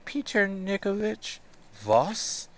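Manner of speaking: put-on high, slow little-girl voice asking "Peter Nikovich Voss?"; small child calling out from inside the armor, high and slow; child's voice from the armor. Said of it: "Oh, oh, oh, from the armor".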